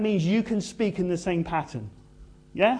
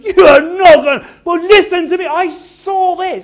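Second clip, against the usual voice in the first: high, loud, bursting with joy; joyful, laughing excitement; 13 semitones higher, 16 dB louder than usual. Acted. Jesus filled with joy telling disciples, loud and delighted. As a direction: shout it full of delight, slowing at the end. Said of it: "he's laughing his head off".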